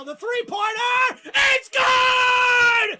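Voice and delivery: big play-by-play call, loud and high; thrilled commentator yelling the basket; excited shout. Real, not acted. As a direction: roar it as the shot drops. Clutch three, play-by-play call.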